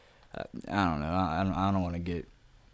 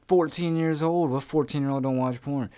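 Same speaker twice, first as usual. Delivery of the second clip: raised-pitch, louder defensive retort; mock-indignant teenage comeback; indignant protest; 6 semitones higher, 3 dB louder than usual. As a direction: argue back, pitched up and incredulous. Acted defensive retort to a girl.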